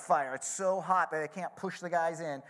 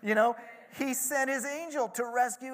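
builds from hesitant to a much higher, louder praise; amazed king; 8 semitones higher, 7 dB louder than usual. Retold or imitated. King praising the God of the three, mounting amazement. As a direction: start unsure, then burst into praise. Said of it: "He's amazed".

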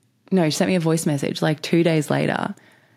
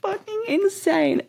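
amazed; slower than usual. Real, not acted. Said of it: "amazed at friend's change".

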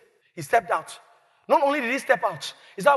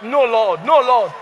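louder protest; 2 semitones higher, 7 dB louder, slower than usual. Retold or imitated. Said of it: "They look at it and said".

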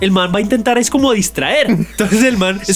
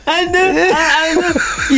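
high, laughing fake confusion; mock deaf man; 9 semitones higher, much slower than usual. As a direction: play dumb like he can't hear a thing.

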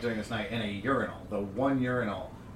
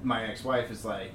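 normal talking voice

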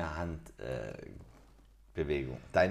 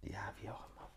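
whispered; 3 semitones higher, 12 dB quieter, faster than usual. Real, not acted. Trailing off, resigned.

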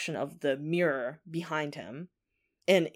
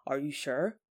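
put-on softer, understated child's question; low voice; 3 semitones lower, 5 dB quieter than usual. Curled-up son to his father, in a low voice. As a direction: ask it low and quiet, lightly acted. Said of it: "says in a low voice".

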